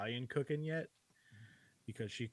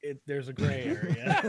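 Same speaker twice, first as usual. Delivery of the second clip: laughing through the words; 10 semitones higher, 10 dB louder than usual. Real, not acted. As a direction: laugh as you answer. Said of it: "Why are you standing in the refrigerator".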